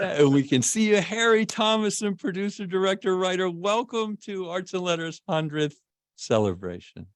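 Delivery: high-pitched, ceremonial guest introduction; announcer's welcome. Real, not acted. Host introduces guest to audience.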